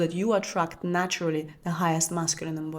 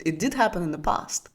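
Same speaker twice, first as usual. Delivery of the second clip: usual speaking voice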